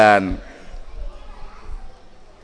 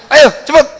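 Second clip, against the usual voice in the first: loud, high, sharp hurry-up; impatient order; 10 semitones higher, 8 dB louder than usual. Retold or imitated. Husband barking at his wife to hurry. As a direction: push her to move now.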